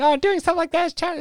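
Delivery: high, prissy mock voice; mocking critics. He mocks people who judge rough play, high and prissy. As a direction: parody a disapproving scold. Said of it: "people go off and it's like".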